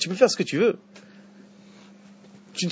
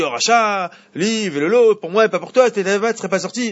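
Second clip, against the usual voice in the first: raised, louder, pushing him away with scorn; vindictive intonation; 6 semitones higher, 5 dB louder than usual. Shown as a demonstration. Teacher reading the verse to the son, vindictive and louder. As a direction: spit the words at him like a rejection. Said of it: "intonation, euh, vindicative".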